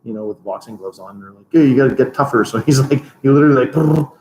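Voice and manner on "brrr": normal talking voice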